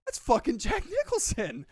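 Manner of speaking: high, held-back mock disbelief; starstruck aside. Retelling his double take at a star, starstruck aside. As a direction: squeeze it high without raising the volume. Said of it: "I was like".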